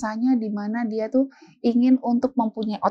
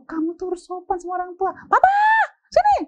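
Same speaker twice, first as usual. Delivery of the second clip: high, mock scolding and summoning; shouting mother; 11 semitones higher, 4 dB louder than usual. Mama preaching manners then summoning her husband, hammed-up shouting. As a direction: lecture sharply, then holler for Papa. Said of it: "Teriak-teriak tuh".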